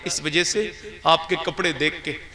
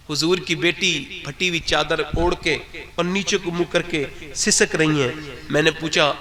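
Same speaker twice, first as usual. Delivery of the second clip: ordinary talk, nothing marked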